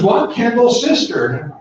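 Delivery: lightly voiced young woman introducing herself. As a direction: say it brightly, as if obvious. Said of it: "she goes".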